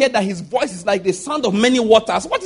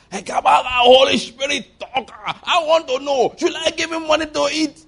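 higher, slow, hammed-up pleading; mock pleading prayer; 5 semitones higher than usual. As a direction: beg the Spirit in a mock shout. Mock prayer to Holy Spirit.

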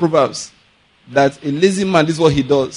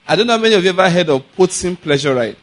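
usual speaking voice

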